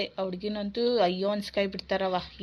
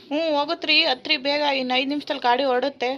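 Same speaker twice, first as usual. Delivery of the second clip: loud, higher conductor's voice; calling to passengers; 4 semitones higher, 6 dB louder than usual. Acted. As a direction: hurry them onto the bus. Conductor hurrying passengers aboard. (Conductor ಜೋರು ಕೂಗು)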